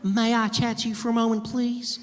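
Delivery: hammed-up Southern belle, sweet and high. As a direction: coo it like a flirty Southern belle. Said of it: "she was like".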